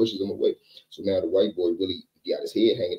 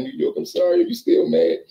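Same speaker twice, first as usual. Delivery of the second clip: pleading Billy; 14 semitones higher, 5 dB louder than usual. Retold or imitated.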